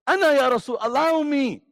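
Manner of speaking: loud, high, urgent begging; eager plea. Acted. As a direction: plead to be sent to fight, loud and high. Ali begs to fight.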